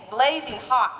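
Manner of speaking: ordinary delivery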